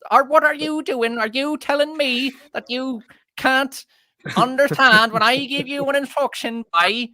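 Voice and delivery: high, loud mock West Country accent; horrific stage English accent. Host mocking the show's actors' horrific southwest England accent. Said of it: "just this horrific accent".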